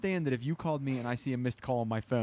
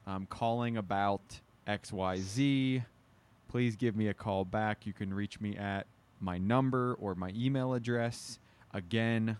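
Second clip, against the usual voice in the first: slow, measured voicemail read; formulaic voicemail; 2 semitones lower, slower than usual. Shown as a demonstration. Demonstrating how he leaves a voicemail, slow and formal. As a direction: spell it out slowly like a careful message. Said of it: "very formulaic".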